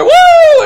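loud, very high, long "woo"; imitating an ecstatic worshiper's holler; shouted whoop. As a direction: let out a piercing revival whoop. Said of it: "he was shouting, he was going".

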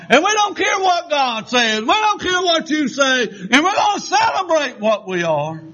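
high-pitched shouted caricature of proud protesters; mock defiant crowd. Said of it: "they came along and they said".

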